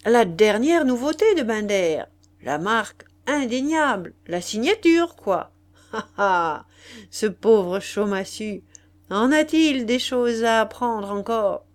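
aristocrate condescendant, fort, avec un petit rire; marquis moqueur. Acted. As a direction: bonimenteur amusé et hautain. (marquis goguenard, voix forte)

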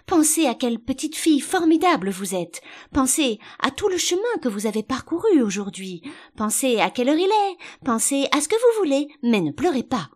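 high, loud, insistent plea; desperate urging. A narrator's reading. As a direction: pile up each appeal to stop the tears. Queen desperately urging Alice not to cry.

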